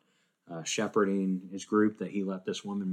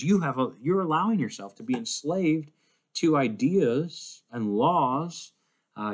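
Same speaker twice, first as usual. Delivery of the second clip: higher-pitched, urgent rebuke; impassioned admonishing; 5 semitones higher, 3 dB louder than usual. Acted. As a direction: plead and scold as Paul to his flock. Paul admonishing the Corinthians.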